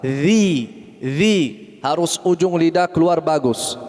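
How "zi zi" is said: "Zi, zi." voiced without the tongue tip out; wrong zal sound. Shown as a demonstration. Teacher showing students the wrong zal. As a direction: buzz "Zi, zi." as a plain z. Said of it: "begini, salah".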